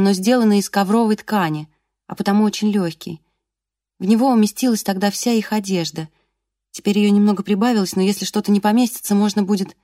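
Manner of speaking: usual speaking voice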